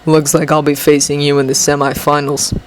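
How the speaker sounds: low, cool rival voice; low, unimpressed rival; low boy voice. Acted. Shinso's voice in story.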